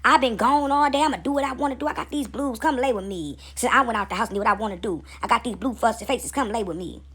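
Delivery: ordinary talk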